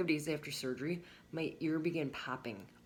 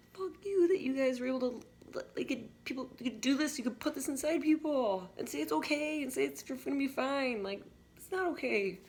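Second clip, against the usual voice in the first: indignant rant; 10 semitones higher than usual. Real, not acted. Angry rant at doctors.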